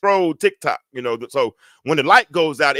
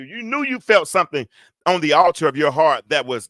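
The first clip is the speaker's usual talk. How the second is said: ordinary talk, nothing marked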